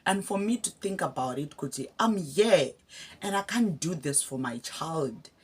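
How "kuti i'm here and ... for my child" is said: ordinary delivery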